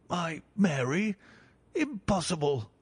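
a father refusing to believe; stunned disbelief. Acted. Banker stunned by news.

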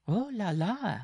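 very high-pitched bride voice; bride crying out. A narrator's reading. Bride seizing the struggling dwarf, crying out high. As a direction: exclaim it way up high. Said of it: "she cried, seizing the struggling dwarf".

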